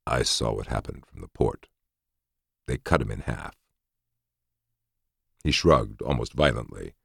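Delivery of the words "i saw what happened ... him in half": low, hushed voice; very quiet. A narrator's reading. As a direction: speak very quietly. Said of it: "His voice was very quiet when next he spoke".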